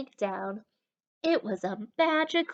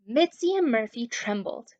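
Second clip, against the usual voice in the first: ordinary delivery, nothing marked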